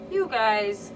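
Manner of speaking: light Midwestern lilt on "You guys". Shown as a demonstration. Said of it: "I always say you guys".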